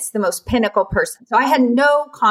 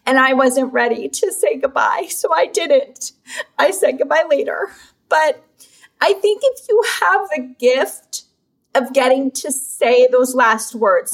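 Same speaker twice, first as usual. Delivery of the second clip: fighting to get the words out while tearing up; holding back tears; 2 semitones higher than usual. Real, not acted. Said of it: "And I'm gonna cry".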